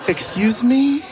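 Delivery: high, incredulous "Excuse me?"; mock shocked "Excuse me?". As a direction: act offended and stunned. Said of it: "I was like".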